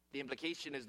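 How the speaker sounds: ordinary delivery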